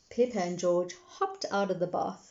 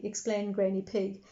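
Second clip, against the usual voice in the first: usual speaking voice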